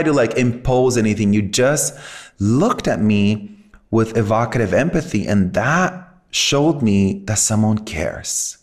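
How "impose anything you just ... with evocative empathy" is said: ordinary talk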